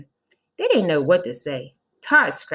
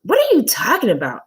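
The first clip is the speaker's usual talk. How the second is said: higher, louder confused question; puzzled boy; 9 semitones higher, 7 dB louder than usual. Acted. Todd confused by the teacher, louder and higher. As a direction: ask baffled. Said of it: "asked Todd".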